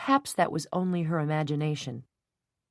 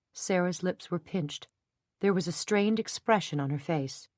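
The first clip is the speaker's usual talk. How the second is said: ordinary delivery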